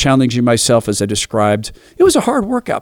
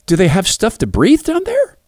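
put-on light little girl voice; 10 semitones higher than usual. Daughter asking about hippos.

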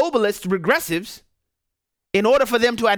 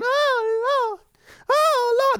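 high, mock crying "Oh, Lord"; hammed-up high wail of despair; much slower than usual. Mocking critics crying over the strike, in a high wail. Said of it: "all these people crying".

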